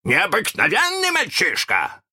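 guessing pirate. A narrator's reading. Pirate captain tries another guess, insistent. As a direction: push the guess up high.

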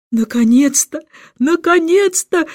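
mild delighted cry, stretched; joyful cry. A narrator's reading. King hugs the stranger, overjoyed cry. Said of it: "кричал он".

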